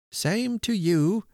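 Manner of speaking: put-on high, slow old man's reply; high-pitched voice. A narrator's reading. Deaf old man returning a child's greeting, high-pitched. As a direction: draw it out, pitched well up. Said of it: "said he in a high-pitched voice".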